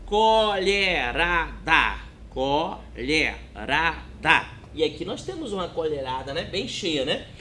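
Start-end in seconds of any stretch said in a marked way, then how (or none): 0.0-2.0 colherada read out slowly as CO-LHE-RA-DA; syllable by syllable. Shown as a demonstration. Teacher modelling reading aloud, colherada drawn out syllable by syllable. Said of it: "Leia em voz alta a palavra". 2.3-4.5 Colherada very slow and lower, CO, LHE, RA, DA; syllable by syllable. Shown as a demonstration. Teacher repeating for pupils to read along, Colherada split slowly into syllables. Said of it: "Leia em voz alta a palavra".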